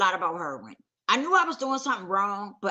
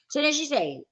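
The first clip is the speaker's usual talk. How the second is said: ordinary talk, nothing marked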